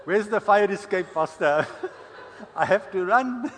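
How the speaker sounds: 笑いながら大声で冗談を言う; laughing. Real, not acted. Speaker laughing at own joke.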